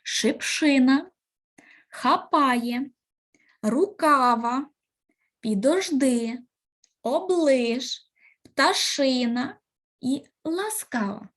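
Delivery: each word slow with the stress clearly marked, «Шипшина» stressed on «ши»; careful word stress. Shown as a demonstration. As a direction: read every word slowly, leaning on the stressed syllable. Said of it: "правильно ставлячи наголос". (повільно, чітко наголошуючи кожне слово)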